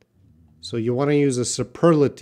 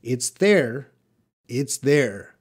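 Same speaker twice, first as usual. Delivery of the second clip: normal talking voice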